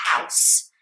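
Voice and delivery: "House" in one syllable, ending on a hissed S. Shown as a demonstration. Teacher shows learners one syllable, crisp "House". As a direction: say "House" short, with a clean S and no vowel after. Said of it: "it's just house".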